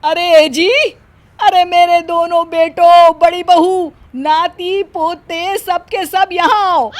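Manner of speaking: high shrill calling of the whole family; loud yelling. Acted. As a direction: yell for everyone to come running. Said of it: "वो जोर से चिल्लाती है".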